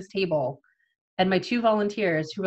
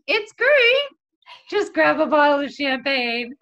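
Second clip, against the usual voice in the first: very high, louder, laughing fake brightness; forced cheer; 6 semitones higher, 6 dB louder than usual. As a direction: pretend all is fine, cracking. Forced cheer while overwhelmed.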